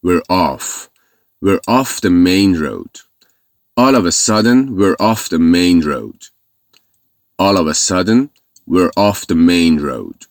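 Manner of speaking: slow, careful drill of the phrase; slow model reading. Shown as a demonstration. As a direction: build the sentence up slowly for learners. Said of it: "زدیم بیرون".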